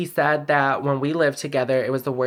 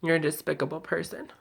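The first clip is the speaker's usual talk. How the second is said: much softer, hurt and contemptuous; quiet condemnation; 7 dB quieter than usual. Real, not acted. Addressing her absent ex, quietly condemning him. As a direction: condemn him under your voice after a long silence.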